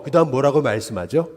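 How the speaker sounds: usual speaking voice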